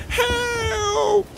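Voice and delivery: very high, loud squeal; long storybook shriek; panicked cry. A narrator's reading. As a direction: wail for rescue. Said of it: "the other one cried".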